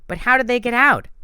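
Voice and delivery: high, loud objection; protesting. A narrator's reading. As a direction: push back hard on his theory. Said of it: "Annabeth protested".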